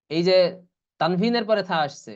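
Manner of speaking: ordinary talk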